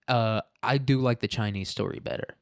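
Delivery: normal talking voice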